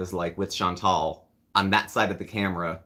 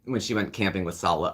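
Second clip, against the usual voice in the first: ordinary talk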